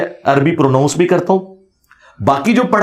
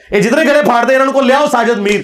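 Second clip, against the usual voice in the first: ordinary delivery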